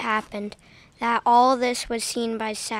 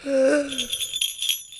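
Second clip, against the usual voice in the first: sharp gasp of horror; horrified gasp. Acted. Miser gasps at his wife's lavish offer. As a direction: gasp as if struck. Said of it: "Sushil gasped in horror".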